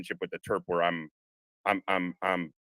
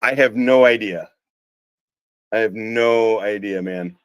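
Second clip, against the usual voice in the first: raised voice to someone across the way; real louder off-mic reply; calling out; 7 dB louder, slower than usual. Real, not acted. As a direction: call the answer over, louder. Answers someone off mic about crash.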